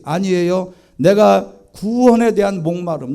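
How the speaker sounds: usual speaking voice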